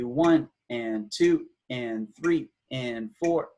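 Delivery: slow, evenly spaced count; measured metronomic counting; eighth-note counting. Shown as a demonstration. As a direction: count steadily with "and" between beats. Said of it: "if we were subdividing this into".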